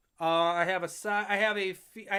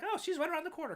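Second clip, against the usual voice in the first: pitched up high, airy and offhand; breezy mock quote; 10 semitones higher, faster than usual. Mimicking someone who calls a two-hour drive close. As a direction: toss it off like it's nothing. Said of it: "That's like".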